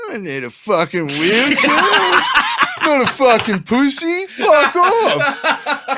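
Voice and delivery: high, strained mock outburst; imitating a stroppy, indignant mate; indignant refusal. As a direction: protest loudly through rising laughter. Acted drug-addled friend refusing wheelchair.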